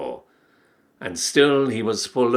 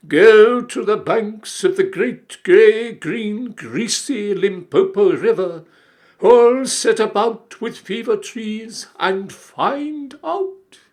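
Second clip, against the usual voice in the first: loud, high, slow wailing call; mournful cry; 10 semitones higher, 8 dB louder, slower than usual. A narrator's reading. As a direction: call it out like a mournful town crier. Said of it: "with a mournful cry".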